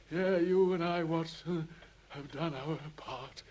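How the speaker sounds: usual speaking voice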